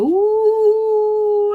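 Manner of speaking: a high, loud squeal of delight; excited ooh. Retold or imitated. Host showing viewers how she flips her lid, high excited ooh. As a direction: let out a thrilled high ooh. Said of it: "I get excited and go".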